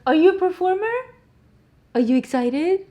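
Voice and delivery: talking to her cat in a high pet voice. Real, not acted.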